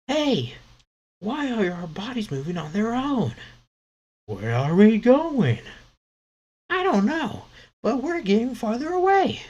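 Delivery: put-on very high, loud child voices; high panicky kid voices; scared little kids. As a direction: squeak it out like frightened little kids. Kids voiced while reading manga.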